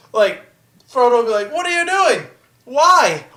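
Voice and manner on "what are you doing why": high-pitched naive pleading; mock whiny protest. Frodo defending Gollum to Sam, mock whiny. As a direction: protest like a wounded innocent, pitched up.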